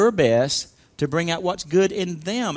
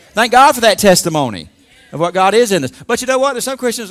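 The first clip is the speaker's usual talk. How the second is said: usual speaking voice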